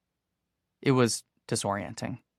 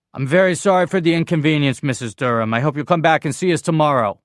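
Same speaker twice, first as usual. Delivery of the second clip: loud apology; 5 semitones higher, 9 dB louder than usual. A narrator's reading. Manager placating customer loudly.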